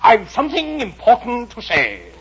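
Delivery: high, very loud caterpillar call. A narrator's reading. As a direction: shout after someone walking away. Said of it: "the caterpillar called after her".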